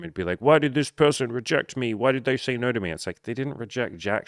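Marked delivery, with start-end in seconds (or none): 0.4-2.9 higher, louder mock-hurt whine; whiny ego tantrum. Coach mocking his own ego's tantrum over rejection, whiny. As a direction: sulk like a wounded ego. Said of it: "my beautiful big ego will wanna throw a little tantrum".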